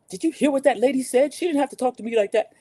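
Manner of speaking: a touch of gossipy outrage; voicing a bystander.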